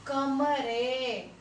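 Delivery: कमरे drawn out, no nasal at the end; slow plain plural. Shown as a demonstration. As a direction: sound out कमरे without nasal. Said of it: "pronounce करके देखो". (कमरे धीरे, बिंदी के बिना)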